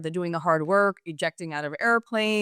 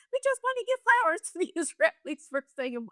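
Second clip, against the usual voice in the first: high, sugary mock-innocent voice with a laugh; a high, laughing send-up of innocent flower-givers; mock Iranians; 12 semitones higher than usual. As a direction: play it sweetly innocent, laughing through it. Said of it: "They're like".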